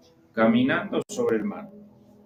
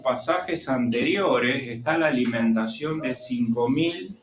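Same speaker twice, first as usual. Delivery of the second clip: ordinary delivery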